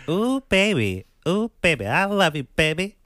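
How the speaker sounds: high, loud lovestruck coo; swooning girlfriend. Retold or imitated. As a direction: coo at him like a smitten girlfriend. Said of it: "And his honey's like".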